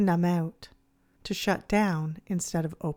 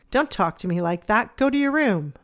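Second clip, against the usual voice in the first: snapping back; 3 semitones higher, 7 dB louder than usual. Acted. Parent snapping back at a yelling child, loud and angry. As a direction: bark it back at the kid. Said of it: "You snap back".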